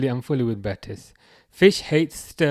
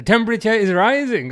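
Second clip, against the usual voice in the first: triumphant announcement; 9 semitones higher, 8 dB louder than usual. Acted. Proudly announcing the translation.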